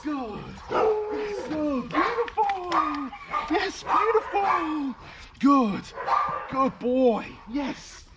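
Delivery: high, bright dog praise. Shown as a demonstration. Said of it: "I'm gonna use more vocal inflection and physical praise".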